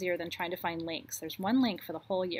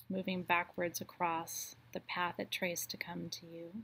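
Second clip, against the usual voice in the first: guided meditation. Acted. Host guides the group to release the circle of light, in a guided meditation voice. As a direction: lead them calmly back around the circle.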